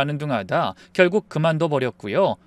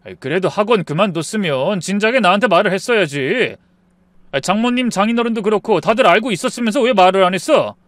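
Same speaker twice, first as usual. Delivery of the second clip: raised, higher exasperated voice; angry reproach; 3 semitones higher, 4 dB louder than usual. Acted. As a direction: confront him, fed up and indignant. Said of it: "얼마나 화가 났는지 몰라요".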